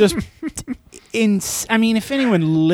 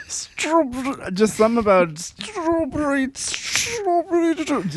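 high, slow goofy voice; character impression; 10 semitones higher than usual. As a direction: savor the words in a silly voice. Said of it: "heard me walking around my house".

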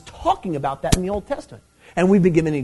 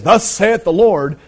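loud, slow, raised prophetic declaration; booming, drawn-out herald's call; prophet's proclamation; 4 semitones higher, 7 dB louder, slower than usual. Acted. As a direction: proclaim it like an Old Testament prophet. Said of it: "Amos comes and says".

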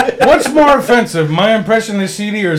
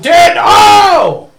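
loud, slow, high triumphant shout; excited shout; 9 semitones higher, 5 dB louder than usual. Retold or imitated. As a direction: call it out like a big win. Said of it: "You're just like".

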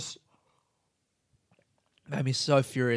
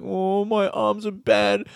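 slow, whiny mock lament of a hero; sulky mock hero lament; mock whiny soldier; 5 semitones higher, slower than usual. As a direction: whine it like a self-pitying action hero. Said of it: "And he's like".

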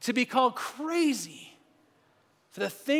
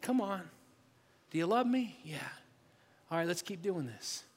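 much softer, intimate closing appeal; quiet reflective preaching; 4 semitones lower, 8 dB quieter than usual. Acted. Pastor gently encouraging fearful listeners, soft and intimate. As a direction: bring it down low and gentle, speaking to each heart.

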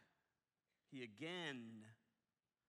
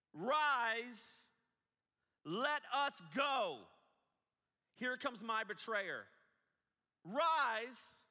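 high, loud call with "let us go" drawn out slowly; yelling; 11 semitones higher, 12 dB louder, much slower than usual. Shown as a demonstration. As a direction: rouse the sleepers with a shouted call. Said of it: "why are you yelling".

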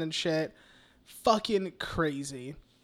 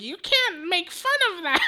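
higher, prissy scolding voice; mock scold; 11 semitones higher than usual. Retelling the talk-show scene, higher mock scold. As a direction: wag a finger at the joke in a high voice. Retold scolding from movie character.

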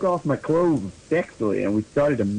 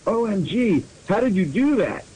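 usual speaking voice

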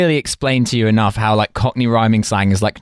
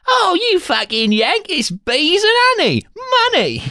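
bad Cockney accent. Hooligan explaining slang to the yank, exaggerated bad Cockney.

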